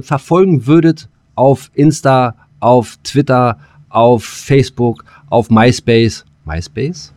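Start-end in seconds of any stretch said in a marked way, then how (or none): none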